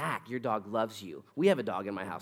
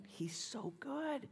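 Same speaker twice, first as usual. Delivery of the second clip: whispered, higher and slow, in wonder; awed whisper; 12 semitones higher, 13 dB quieter, much slower than usual. Acted. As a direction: breathe it out as if amazed. Awed aside about God.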